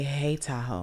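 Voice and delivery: low, soft, falling greeting; an unimpressed low hello; flat low hey. Shown as a demonstration. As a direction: drop it low and quiet. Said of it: "Three different energies".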